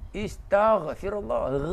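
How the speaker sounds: slow "Istaghfirullah" with a strong ghain; gh sound shown. Shown as a demonstration. Preacher teaching the ghain sound. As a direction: stretch "Istaghfirullah" and push the gh from the throat. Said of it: "Kena ada rain".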